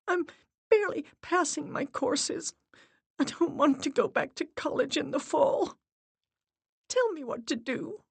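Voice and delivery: high-pitched tearful pleading; voice breaking. A narrator's reading. Miranda confessing to her mother, tearful breaking voice. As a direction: beg for guidance through tears. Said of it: "Miranda's voice broke".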